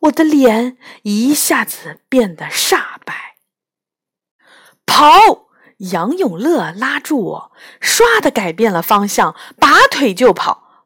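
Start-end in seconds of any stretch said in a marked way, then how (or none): 4.9-5.5 urgent shout. Acted. Fleeing soldiers, urging escape.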